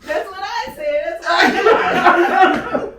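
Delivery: high, loud words squeezed through laughter; laughing squeal. Real, not acted. Pregnant friend laughing at her own shock, squealing.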